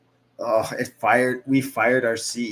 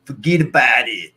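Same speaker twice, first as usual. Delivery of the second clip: put-on loud, punchy New York accent; put-on New York accent, louder; 7 dB louder than usual. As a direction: do a hammed-up New Yorker. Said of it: "in one New York accent".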